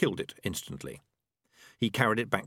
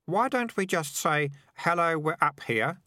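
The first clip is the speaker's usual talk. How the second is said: high, slow, guileless suggestion; mild innocent boy's voice; 9 semitones higher, 3 dB louder, slower than usual. A narrator's reading. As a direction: suggest it naively, pitched up. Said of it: "said Keith innocently".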